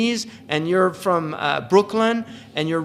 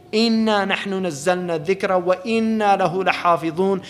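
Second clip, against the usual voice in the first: recited verse in Arabic, set apart from the speech; Quran recitation. Acted. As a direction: intone the verse reverently.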